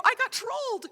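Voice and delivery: high-pitched, excited re-enacted squeal; gleeful squeal. Telling husband the big news, gleeful high squeal. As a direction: burst out with it, high and giddy.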